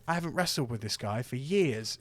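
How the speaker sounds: ordinary talk, nothing marked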